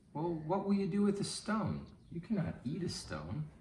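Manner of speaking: put-on high, thin old woman's voice; old lady voice. Old lady questioning the hungry young man, high doubtful voice.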